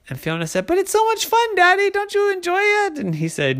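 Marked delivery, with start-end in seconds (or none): 0.7-3.0 put-on squeaky high child voice; gleeful little girl. A narrator's reading. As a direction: plead happily, pitched far up.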